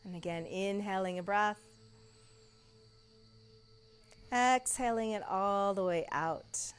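soft, slow guided meditation voice; soft spa whisper. Acted. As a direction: lull them into stillness. Guided relaxation in Shavasana.

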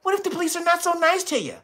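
high, gentle, overly nice voice for the viewers; the soft-talking version he refuses to be; mock soft-spoken nice guy. Shown as a demonstration. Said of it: "I'm not gonna talk to you".

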